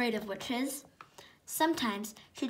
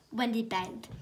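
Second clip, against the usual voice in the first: ordinary delivery, nothing marked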